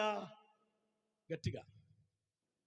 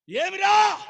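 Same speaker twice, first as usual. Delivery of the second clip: shocked outcry; 12 semitones higher, 14 dB louder, much slower than usual. Retold or imitated. Pastor shocked by a baptism request.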